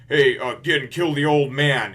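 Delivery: loud, slowed, commanding bark; a pirate boss barking an order across the deck; shouted order. Retold or imitated. Don Krieg shouting to Gin, loud slow command. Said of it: "getting shouted out from Don Krieg".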